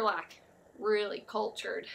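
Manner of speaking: ordinary talk, nothing marked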